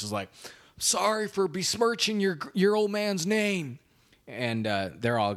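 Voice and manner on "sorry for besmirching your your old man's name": much higher, put-on movie-hero voice; hammed-up dramatic farewell as Ed Harris; playfully bad Ed Harris; Ed Harris impression. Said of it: "my Ed Harris impression".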